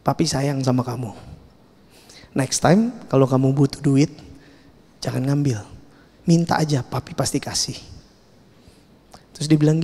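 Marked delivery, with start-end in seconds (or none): 0.1-5.7 soft, low fatherly reassurance; gently forgive your child, voice lowered; ayah menenangkan. Retold or imitated. Father forgives and hugs his son. 6.2-7.7 very soft fatherly reassurance; ayah menenangkan. Retold or imitated. Father reassures his forgiven son.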